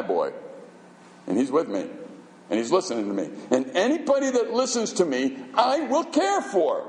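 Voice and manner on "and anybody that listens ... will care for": God's promise. Retold or imitated. God voiced promising care.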